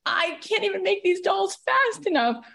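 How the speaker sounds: high, lightly voiced friend's exasperation; mock-frazzled doll maker; imitating friend. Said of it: "she's like".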